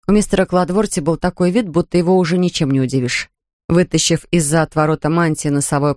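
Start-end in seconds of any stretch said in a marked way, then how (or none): none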